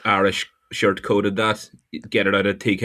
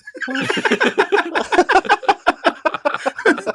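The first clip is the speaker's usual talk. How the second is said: a long, high, helpless laugh; long high cackle. Real, not acted. Laughing at the story.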